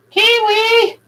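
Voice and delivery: high call out. Real, not acted. Calling out for her pet bird Kiwi, high and drawn out. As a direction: call the bird's name high and long.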